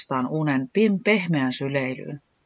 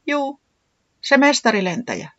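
small, high-pitched reply; timid little Tiputi; 10 semitones higher than usual. Acted. Tiputi answers Katiaa kansa from the eggshell, timidly.

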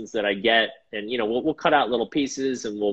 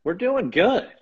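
high playful voice; 11 semitones higher, slower than usual. Acted. Playful high greeting reply.

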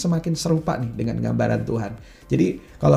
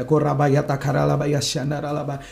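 speaking in tongues; 3 semitones higher than usual. Real, not acted. Speaking in tongues during prayer.

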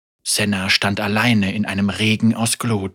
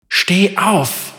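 urgent command; 8 semitones higher than usual. Acted. Inner voice commanding Senna.

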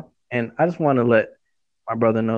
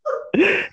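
high laughing fit. Real, not acted. Bursts out laughing.

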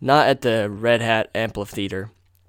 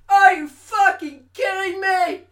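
very high, slow, stretched outrage; mock outraged thought, pitched way up; exasperated disbelief; slower than usual. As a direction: drag out the disbelief. Thinking in disbelief.

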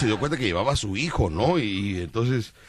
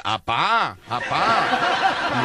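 put-on a child's higher call, laughing; imitating the son; 7 semitones higher, much slower than usual. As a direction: call out like a little boy to his dad. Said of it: "Y su hijo, hijo, decía".

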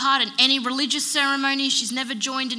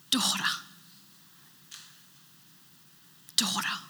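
tender hushed word; 5 dB quieter than usual. Acted. Repeating Jesus's tender word.

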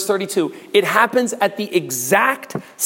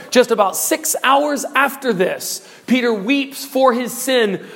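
ordinary talk

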